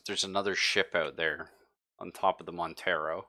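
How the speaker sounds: normal talking voice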